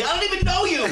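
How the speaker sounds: high mock-indignant outburst; panicked refusal. Prank victim refusing caller's plea for help, panicked refusal. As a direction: imitate a man refusing in alarm, pitch way up.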